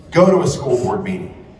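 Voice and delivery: usual speaking voice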